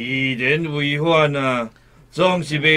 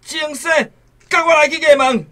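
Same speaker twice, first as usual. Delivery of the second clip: high, commanding demand; stern order; 10 semitones higher, much faster than usual. Acted. Official ordering Deshen to the yamen, stern and high. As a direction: drag him to court.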